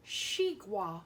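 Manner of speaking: xigua said as shee-gwa in Mandarin; Chinese xigua. Shown as a demonstration. Teacher names watermelon in Chinese for pupils, shee-gwa. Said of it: "in Chinese, it is called".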